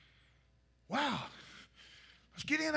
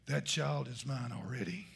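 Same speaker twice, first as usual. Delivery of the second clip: much lower and much softer, ending in a whisper; God's cold verdict; 14 semitones lower, 10 dB quieter than usual. Retold or imitated. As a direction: pronounce the verdict deep and hushed. God answers the drowning mother.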